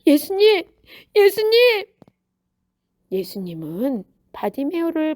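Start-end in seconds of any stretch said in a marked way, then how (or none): 0.1-1.9 high, loud, eager call; delighted shout of greeting; thrilled, joyful. A narrator's reading. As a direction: call out with a fluttering happy heart. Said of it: "설레고 기쁜 마음으로".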